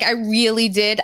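usual speaking voice

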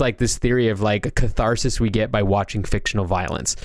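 ordinary talk, nothing marked

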